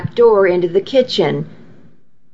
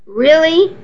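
high squeaky question; 7 semitones higher than usual. A narrator's reading. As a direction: squeak it out in disbelief. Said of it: "he asked in a squeaky voice".

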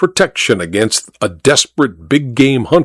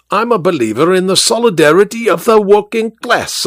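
raised, higher cabman's speechifying voice; working-class orator; 6 semitones higher than usual. Acted. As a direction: lecture him like a union orator. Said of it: "something like a John Burns oration".

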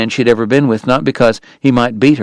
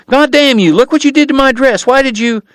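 furious Kate; 14 semitones higher than usual. Acted. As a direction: rage at him over the dress. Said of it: "she cried".

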